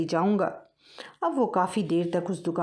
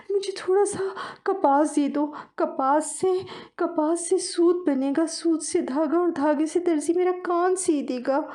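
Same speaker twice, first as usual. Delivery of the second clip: little mouse begging the field; 10 semitones higher than usual. Acted. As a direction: plead with the field, high.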